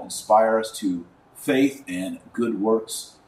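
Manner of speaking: normal talking voice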